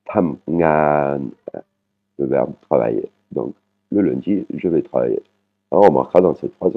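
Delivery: ordinary talk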